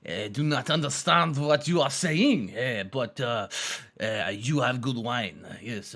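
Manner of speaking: put-on low, slow, soft French accent; hesitant French guy, low and halting. French guy answering the Italian, French accent. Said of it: "And then the French guy will be like".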